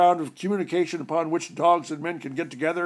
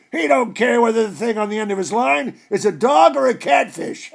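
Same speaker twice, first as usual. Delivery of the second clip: higher, louder, gleeful dog gossip building to a shout; gossiping terrier; 5 semitones higher, 6 dB louder than usual. A narrator's reading. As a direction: spill the master's secret with relish. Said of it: "terrier gossips about master".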